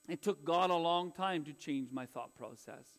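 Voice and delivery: normal talking voice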